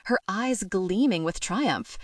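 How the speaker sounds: usual speaking voice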